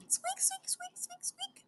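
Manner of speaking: tiny, very high soft squeaks; mouse squeak. Shown as a demonstration. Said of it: "make a mice squeak".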